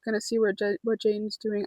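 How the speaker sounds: softly stumbling over words, restarting mid-name; nervous stumbling. Real, not acted. Vlogger nervous after a job interview, softly stumbling. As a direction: trip quietly on the name and correct it. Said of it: "I'm stuttering a lot".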